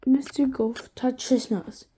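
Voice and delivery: ordinary delivery, nothing marked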